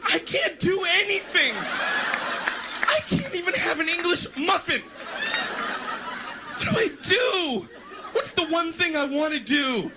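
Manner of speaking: high, drawn-out helpless wail; comic despair. Acted. Acted despair lying in bed.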